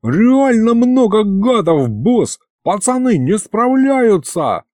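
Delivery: high, slow, laboured battle report; broken, straining voice. A narrator's reading. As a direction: force the words out while fighting. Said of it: "голос его был прерывистым".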